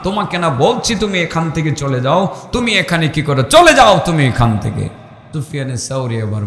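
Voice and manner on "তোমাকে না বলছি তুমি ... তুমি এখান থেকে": higher-pitched mock rebuke, opening loud; storyteller's raised scolding voice; retold scolding. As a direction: act out someone sternly ordering him away. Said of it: "সেই লোকটা ধমক দিয়ে বলল".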